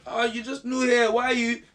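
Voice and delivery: high, put-on sneering voice; a high mocking minister's whine; mocking the ministers. Ministers scoffing at the new president, high and mocking. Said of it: "some of these guys were thinking".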